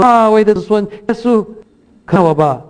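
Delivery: pleading call of a blind beggar; blind man calling out. Retold or imitated. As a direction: cry out for help from the roadside. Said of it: "就喊着说：".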